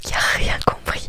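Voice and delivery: normal talking voice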